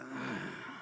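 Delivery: low, quiet exhale; bored sigh. Retold or imitated. Audience sitting back before the punchline, bored sigh. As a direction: sigh and give up on it.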